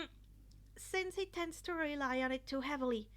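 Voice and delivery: ordinary delivery, nothing marked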